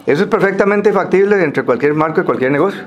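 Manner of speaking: normal talking voice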